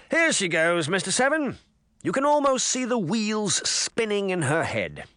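high, mocking taunt. A narrator's reading. Mayor mocking Relda to Mister Seven, high and scornful. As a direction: sneer at her to your assistant.

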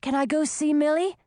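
high, eager question; excited. A narrator's reading. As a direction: ask as if barely able to stand still. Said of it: "suddenly so excited that he found it hard".

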